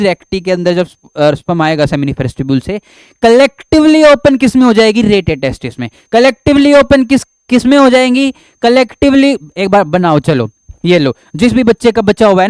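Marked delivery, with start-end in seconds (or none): 3.2-9.4 higher, louder quiz prompt; raised quizzing teacher voice; prompting the class. Shown as a demonstration. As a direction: push the students to answer.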